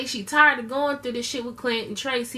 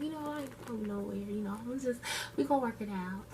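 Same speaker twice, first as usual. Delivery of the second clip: much softer mock pleading to the landlord; soft, sheepish pleading aside; hushed pleading; 11 dB quieter than usual. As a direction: murmur it like you're begging to stay.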